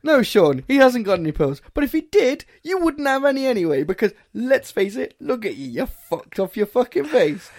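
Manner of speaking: loud, high exasperated scolding; retold telling-off. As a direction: tell off a drunk friend. Retells telling drunk friend off.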